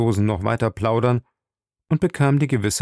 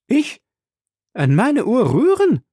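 high, loud, affronted exclamation; indignant cry; 13 semitones higher, 5 dB louder than usual. Acted. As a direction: cry out as if insulted. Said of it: "rief Passepartout".

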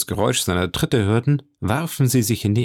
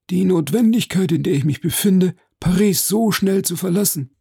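put-on high-pitched, earnest old-fashioned complaint; effusive Major lamenting; 10 semitones higher, 3 dB louder than usual. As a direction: lament it in a raised character voice. (klagender Major, mit hoher Stimme)

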